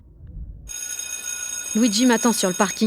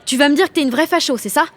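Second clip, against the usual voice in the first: challenging; 6 semitones higher, 4 dB louder than usual. Acted. Quarrel with Vanessa.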